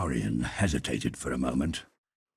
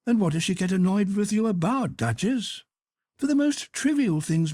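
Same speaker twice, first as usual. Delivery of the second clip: arch, teasing noblewoman, pitched up; high Duchess voice; 7 dB louder than usual. Acted. Duchess challenging Lord Henry, high and teasing. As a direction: play the witty Duchess, high and bright.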